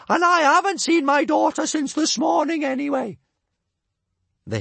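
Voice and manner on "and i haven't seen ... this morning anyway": very high, loud bellow; a mother blustering her way out, bellowing; bellowing Bertha. A narrator's reading.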